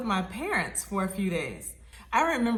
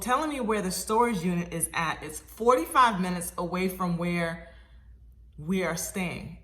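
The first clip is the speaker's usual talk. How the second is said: usual speaking voice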